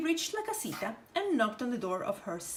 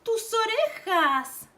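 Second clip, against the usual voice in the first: high, slow and louder; amazed; 11 semitones higher, 6 dB louder than usual. Acted. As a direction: gasp it in wonder. Roja remarking ears.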